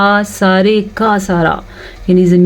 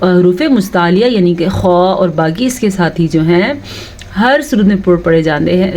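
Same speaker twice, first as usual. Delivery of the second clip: ordinary talk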